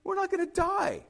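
very high, light reassurance; mock dismissal. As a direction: wave away the panic in a high-pitched voice. Castaway dismisses his friend's panic.

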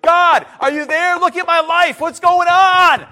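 high, desperate yell to God; acted shouting demand for an answer; forceful, loud shout. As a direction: cry out at full volume, stretching the end. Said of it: "Sometimes it's forcefully and loudly".

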